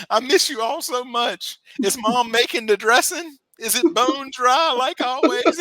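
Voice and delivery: put-on high, loud, earnest homesick kid; Woody on Cheers. Homesick kid calling home at Christmas, mock tearful and high. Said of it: "doing him like Woody on Cheers".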